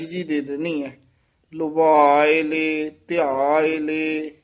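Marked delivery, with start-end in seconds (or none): 1.5-4.3 ਲੁਭਾਏ ਲੇ said slowly, ਲੇ joined on; drawn out, each ਲੇ attached; slow joined recitation. Shown as a demonstration. ਗ੍ਰੰਥੀ ਜੋੜ ਕੇ ਹੌਲੀ ਉਚਾਰਨ ਸਿਖਾਉਂਦਾ. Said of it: "ਇਸ ਤਰ੍ਹਾਂ ਉਚਾਰਨ ਕਰਨਾ ਹੈ".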